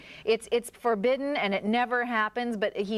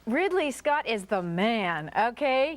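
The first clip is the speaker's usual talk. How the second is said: ordinary delivery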